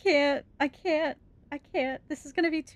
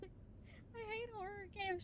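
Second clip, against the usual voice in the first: laugh-crying; 8 semitones higher, 22 dB quieter, slower than usual. Real, not acted.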